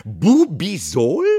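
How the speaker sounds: loud, slow, rising question; outraged repeat. A narrator's reading. Aunt outraged at the boss, incredulously loud. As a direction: throw the name back incredulously. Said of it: "brach sie los".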